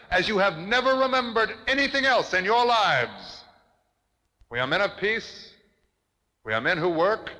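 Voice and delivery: rising fury of a public speech; impassioned accusing oration. Acted. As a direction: denounce the warmakers from a podium.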